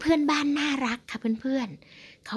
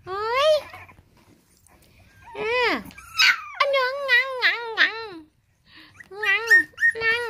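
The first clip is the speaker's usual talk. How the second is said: much higher squeaky imitated whines; puppy whimper imitation; 11 semitones higher, 4 dB louder than usual. Real, not acted. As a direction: mimic the puppy's yelps, high and squeaky. Mimicking the dog's whimpers. (เลียนเสียงหมาครางแหลมสูง)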